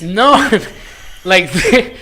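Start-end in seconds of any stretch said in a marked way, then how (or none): 0.4-1.2 high, voiceless burst of laughing; real laughter. Real, not acted. Co-host laughing at his own awkward explanation, high laugh. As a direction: crack up while trying to explain.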